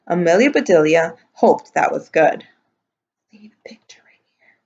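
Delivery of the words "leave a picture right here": breathy whisper, barely there; whispered aside. Real, not acted. Reader whispering an aside during the story. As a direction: slip the instruction in under your breath. Aside while reading aloud.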